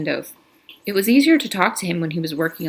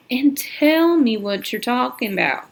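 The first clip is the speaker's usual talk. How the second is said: slow drawl; 6 semitones higher than usual. Acted. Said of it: "in a slow drawl".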